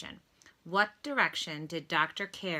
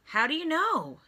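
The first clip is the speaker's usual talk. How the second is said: George's question; 10 semitones higher, 5 dB louder than usual. A narrator's reading. George asks, voiced.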